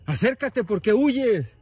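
grito agudo y fuerte hacia lo lejos; llama a gritos a quien se escapa; gritos de llamada. Real, not acted. Vuelve a llamar a la criatura. (gritando con fuerza, retador)

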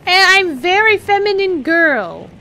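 high, slow, drawn-out feminine put-on; girl voice. Shown as a demonstration. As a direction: insist on being a girl in a high voice. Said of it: "this is my girl voice".

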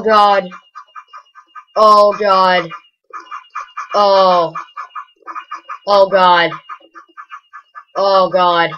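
loud, high, breathless awe at the fireworks; overwhelmed with wonder, almost swooning; awed gushing. Real, not acted. Vlogger gushing over his Minecraft fireworks, loud and awed.

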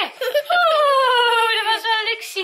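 high excited oeh; surprised squeal. Real, not acted. Sudden squeal finding child.

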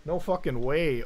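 normal talking voice

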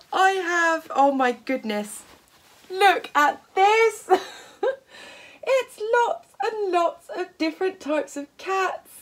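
high, breaking off in delight; overexcited. Real, not acted. Showing viewers the cat fabric, overexcited. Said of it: "I'm getting so overexcited".

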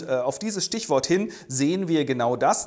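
ordinary delivery

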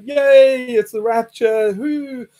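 loud, high cheering as a believer; hammed-up joy at the rapture; mock rapture cheer.

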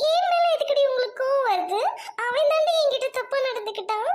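tearful, indignant protest; crying. Acted. Crying girl protesting at being blamed, tearful. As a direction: sob and defend yourself through tears. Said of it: "அழுகாதடி".

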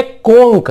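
कोंक slowly, ending in hard "k"; careful K ending. Shown as a demonstration. Teacher demonstrating careful reading, slow कोंक with "k". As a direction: sound out कोंक slowly with final क. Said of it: "इसको ध्यान से पढ़िएगा".

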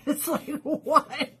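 real laugh through the words; laughing disbelief. Real, not acted. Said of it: "laughs in disbelief".

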